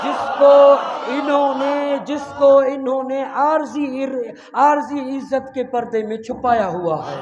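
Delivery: loud, high, slow proclamation; slow down and shout it out; shouted slow oratory. Acted. Preacher exposing hidden enemies, slow shout.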